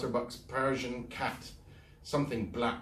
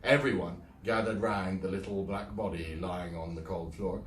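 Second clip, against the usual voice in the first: plain reading voice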